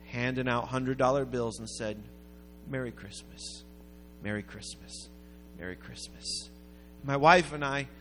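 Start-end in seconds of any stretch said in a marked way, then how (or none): 2.7-6.5 low, quiet greeting, fading with each repeat; gentle, understated imitation of a giver; soft retold greeting. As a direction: repeat it softly, as if passing from person to person. Giver greeting shoppers in turn.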